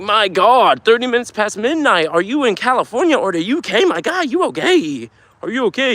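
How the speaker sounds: high, exaggerated disbelief; playful shocked concern, pitched high; mock astonishment. Real, not acted. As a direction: tease the viewer about staying up late. Reacting to chat's late hour.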